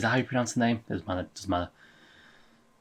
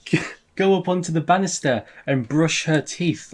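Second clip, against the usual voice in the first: high, incredulous mock quote; mimicking Connie's absurd request in a raised voice; 6 semitones higher, 3 dB louder than usual. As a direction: parrot the absurd request in disbelief. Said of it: "Connie's like".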